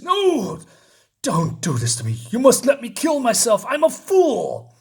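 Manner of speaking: beg, voice stretching on the last line; desperate pleading. Acted. Fool Killer begs Spider-Man to let him die, high and desperate.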